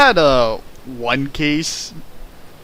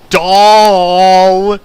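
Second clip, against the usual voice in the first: "doll" blurted much louder and higher; scare-quote emphasis; 6 semitones higher, 10 dB louder than usual. Shown as a demonstration. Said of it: "it's in big scare quotes".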